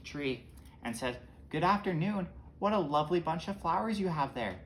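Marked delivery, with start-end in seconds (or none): 1.5-4.6 higher-pitched, sing-song greeting from a wolf; wolf laying on the charm, higher voice. Acted. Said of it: "Then a wolf jumps out".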